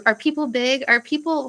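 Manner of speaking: normal talking voice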